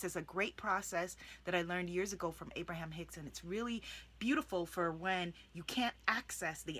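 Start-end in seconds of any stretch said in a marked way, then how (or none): none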